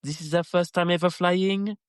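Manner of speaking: slow, halting French mother; French mum. Retold or imitated. French mum introducing her children to a stranger, slow imitation. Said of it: "their mum came by and she said".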